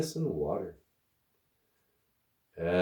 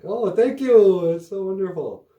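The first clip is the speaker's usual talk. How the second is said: fake gushing thanks; 9 semitones higher, 6 dB louder than usual. To his friend's grandma serving pecan pie again, fake gushing thanks. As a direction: lay the gratitude on thick.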